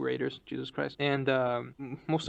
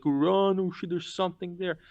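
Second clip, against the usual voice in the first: higher-pitched mock scream of alarm; imitated panicky yell, pitched up; retold scream; 4 semitones higher than usual. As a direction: call out a fake warning like the class clown. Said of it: "he would, like, scream".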